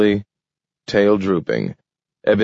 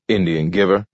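plain reading voice

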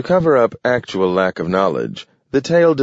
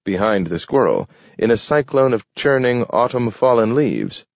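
ordinary delivery, nothing marked

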